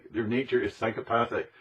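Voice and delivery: ordinary delivery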